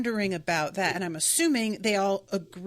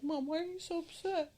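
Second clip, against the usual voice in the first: a higher innocent dog voice; voicing her dog; 7 semitones higher, 10 dB quieter than usual. Voicing her dog asking why mom is upset, innocent and higher. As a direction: give the puppy's confused question. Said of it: "He's over here like".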